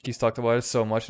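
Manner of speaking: normal talking voice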